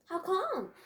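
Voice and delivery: high-pitched timid question. A narrator's reading. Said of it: "Nami said timidly".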